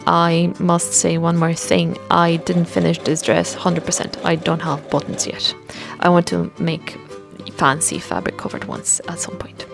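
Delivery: normal talking voice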